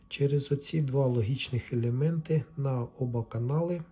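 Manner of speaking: usual speaking voice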